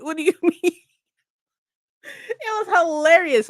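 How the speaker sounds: usual speaking voice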